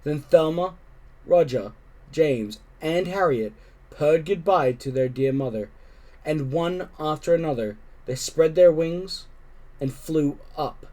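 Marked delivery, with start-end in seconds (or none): none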